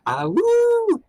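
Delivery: short, very high, loud wolf howl. Acted. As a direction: throw your head back and howl. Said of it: "I will try as well".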